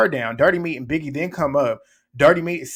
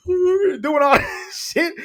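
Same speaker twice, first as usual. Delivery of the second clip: hammed-up wailing, much higher and slow; mock crying; 14 semitones higher, 4 dB louder, much slower than usual. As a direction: imitate a whiny fake cry, half laughing. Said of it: "She was walking away crying".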